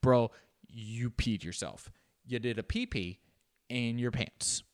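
normal talking voice

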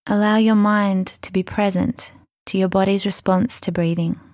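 ordinary talk, nothing marked

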